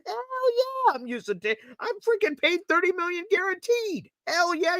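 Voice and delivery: high, thrilled hell yeah as Tanner Engstrand; excited coordinator voice. Pretend. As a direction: play the pumped-up coordinator itching to use him. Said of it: "If I'm Tanner Engstrand".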